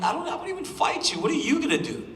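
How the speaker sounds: high-pitched mock taunt; the fighter retells brushing off Arthur with a high-pitched mock taunt; mocking retort. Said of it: "I'm like".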